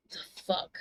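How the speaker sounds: breathy muttered disbelief under the breath; muttered the fuck; whispered aside. Real, not acted. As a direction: drop to a whisper, baffled. Mutters to herself.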